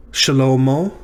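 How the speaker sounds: Shlomo, sounding out shuh-LOH-moh; repeated Hebrew name. Shown as a demonstration. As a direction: slowly repeat Shlomo for the class. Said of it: "This one is Shlomo".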